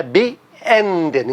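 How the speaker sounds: Beenden, be apart, then stressed EN; stress on middle syllable. Shown as a demonstration. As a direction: land on the EN of Beenden. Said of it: "E N no meio".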